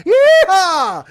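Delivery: high whooping cowboy holler; old prospector yeehaw. Pretend. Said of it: "That's who I am as a show".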